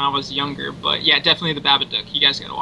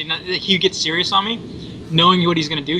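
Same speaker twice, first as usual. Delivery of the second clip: normal talking voice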